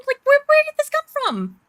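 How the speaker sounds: high-pitched, incredulous question; baffled reaction. Retold or imitated. Recounting a court case's sudden twist, baffled. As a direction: ask it in mock disbelief.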